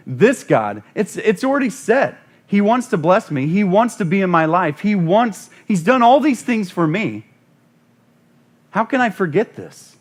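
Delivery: loud, high, building sermon cry; preaching at full force. Acted. Preacher to congregation, rising fervent shout. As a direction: preach it out against the devil.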